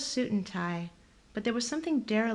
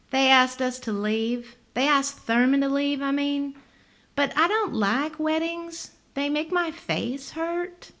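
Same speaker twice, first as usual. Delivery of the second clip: higher, lively woman's voice; 7 semitones higher, 6 dB louder than usual. A narrator's reading. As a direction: voice her high and bright.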